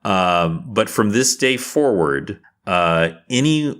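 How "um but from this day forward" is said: ordinary talk, nothing marked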